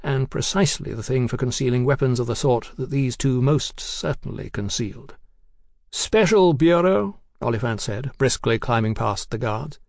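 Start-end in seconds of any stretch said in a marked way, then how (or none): 5.9-7.2 authoritative official. A narrator's reading. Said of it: "Abashed by his accent and manner".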